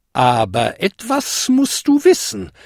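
put-on high, cautioning old lady; fairy godmother's high-pitched warning. A narrator's reading. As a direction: caution the goblin gravely. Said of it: "warnte sie".

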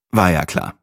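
ordinary delivery, nothing marked

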